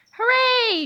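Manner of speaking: high, happy cheer; cheering crowd. A narrator's reading. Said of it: "shouted the crowd of people".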